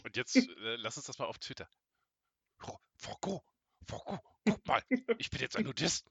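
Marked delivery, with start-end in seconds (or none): 2.7-6.1 higher, louder character line. Acted. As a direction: play the scene for the recording. Performing for Twitter.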